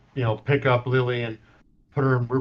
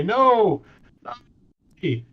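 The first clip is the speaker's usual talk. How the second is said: higher, loud, broken by pauses into single words; tearful denial; 9 semitones higher, 4 dB louder, slower than usual. Acted. Gayla holding Lily's hand to her face, denying it in tears. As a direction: refuse to believe it, one word at a time through tears. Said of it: "sobbing hysterically".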